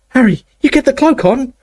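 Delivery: put-on high, urgent girl; young girl voice. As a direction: urge him, high and quick. Hermione urges, voiced higher.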